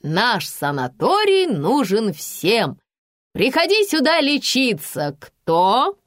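slow, emphatic stage recitation; drawn-out, rising proclamation; loud declaiming. A narrator's reading. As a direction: declaim the verse to a children's audience. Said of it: "доктор громко продекламировала".

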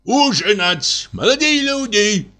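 high call across the room; calling guests to dinner. Acted. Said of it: "кричит". (зовёт гостей к ужину)

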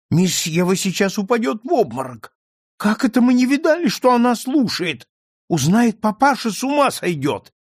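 a servant's frightened exclamation; alarmed outcry. A narrator's reading. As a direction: panic that the child overheard. Dinah alarmed seeing Eva.

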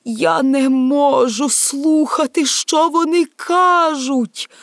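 high, slow, drawn-out protest; anguished cry. Acted. Emperor cannot bear what the heads say, anguished cry. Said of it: "кричав він".

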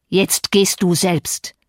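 slow, emphatic angry order; angry snort. A narrator's reading. Heathcliff turns on Earnshaw, snorting angrily. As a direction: snort each word out at him. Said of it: "schnaubte er Earnshaw an".

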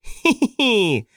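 high, slow mad giggle; crazy clown cackle. A narrator's reading. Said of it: "рассмеялся своим безумным смехом".

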